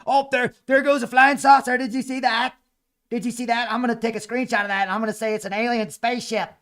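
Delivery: sarcastic, gushing alien spotter; mock excitement. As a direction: fake wonder at a flying saucer. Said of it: "I'm being sarcastic".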